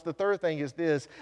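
normal talking voice

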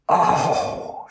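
high, breathy pained moan; a hurt little moan after the fall. Acted. Madeline moans after falling onto the deck. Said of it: "she moans".

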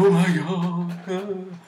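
high, slow drawn-out gasp of wonder; mock awe. The Irish crowd amazed by the shamrock, hammed-up slow awe. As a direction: stretch it out like stunned believers. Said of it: "And everyone went".

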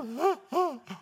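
high, jerky imitation sobs; mock sobbing gasp. Preacher acting out crying at the mirror, high mock sobs. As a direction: gasp as if crying, played for show. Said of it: "It's like one of those moments".

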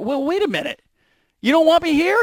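lightly voiced incredulous player; mock quarterback voice. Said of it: "and then he went".

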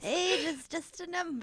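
put-on high, slow quavering old man; quivering old man voice. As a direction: drag it out like a shaky old guy. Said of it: "You hear the quivering in his voice".

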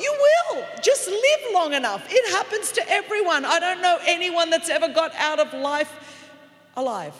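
loud, rising sermon shout; full-force preaching. Acted. Preacher to congregation, loud preaching shout. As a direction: preach it out to the whole church.

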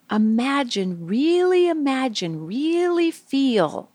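"really" swooping high and stretched each time. Acted. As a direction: coax them, gliding up long on each "really". Urging children to imagine deeply.

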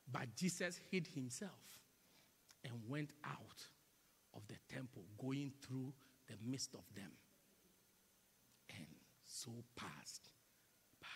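soft, low, fading to a whisper; hushed preaching. Acted. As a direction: let the verse fade to a whisper.

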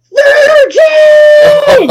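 huge high yell of Virgin; sudden booming battle cry; shouted. Retold or imitated. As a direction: bellow it across the lot like a charging warrior. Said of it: "and goes".

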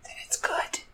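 soft unvoiced whisper. Real, not acted. She whispers to viewers that the bun makes it good. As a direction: whisper it like a secret.

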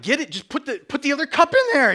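rising high panicked shout; panicked yell. Retold or imitated. Husband yelling at wife over a bee, panicked shout. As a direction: yell it, scared of the sting.

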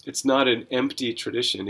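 ordinary talk, nothing marked

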